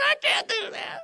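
very high, loud mock wail; breaking down crying. Clerk breaking down before angry man, mock crying. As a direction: imitate a tearful breakdown in a squealing high voice. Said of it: "she just broke down and started crying".

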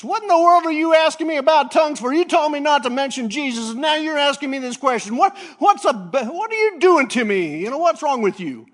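high, loud exasperated shouting; indignant outburst. Retold or imitated. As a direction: yell at him in disbelief.

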